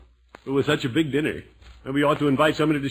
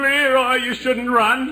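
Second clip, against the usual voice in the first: calling after; 11 semitones higher, 5 dB louder, much slower than usual. Acted. Calling after fleeing Leroy.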